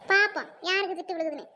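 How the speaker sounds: ordinary talk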